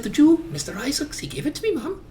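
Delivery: put-on high-pitched small boy voice; little boy. Son explaining the coin to Mum, high little-boy voice. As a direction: pipe up high like a child. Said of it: "a little boy".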